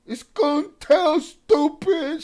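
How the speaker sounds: squeaky mock voice of the commenter, drawn out; mocking imitation. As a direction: ham up the fool who can't finish a sentence. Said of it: "can't finish a sentence properly".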